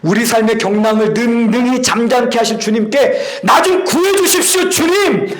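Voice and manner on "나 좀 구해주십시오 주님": high, urgent cry; acted plea, pitch pushed right up; crying out to God. Believers begging the Lord to rescue them, crying out. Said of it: "우리가 밤낮으로 부르짖었음에도".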